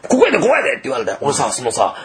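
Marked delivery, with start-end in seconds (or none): 0.1-0.7 manager calling him over. Retold or imitated.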